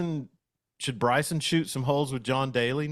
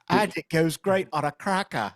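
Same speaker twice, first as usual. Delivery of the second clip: higher, put-on skit pitchman voice; Chris Farley skit voice; 7 semitones higher than usual. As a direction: ham up a goofy TV ad character. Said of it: "And then Chris Farley with those jagged teeth".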